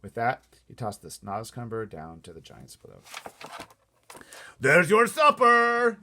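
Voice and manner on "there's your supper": slow, loud, high taunting shout; gleeful shout. A narrator's reading. BFG taunting the giants below, gleeful shout. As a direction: call it down into the pit. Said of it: "he shouted".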